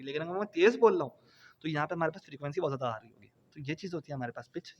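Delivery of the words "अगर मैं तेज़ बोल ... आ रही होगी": fast demo. Shown as a demonstration. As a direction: rattle it off quickly to demonstrate. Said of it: "जिस तरह मैंने तेज़ बोल के डिफाइन किया". (तेज़ी से और ऊँची आवाज़ में बोलकर दिखाना)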